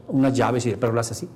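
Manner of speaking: ordinary talk, nothing marked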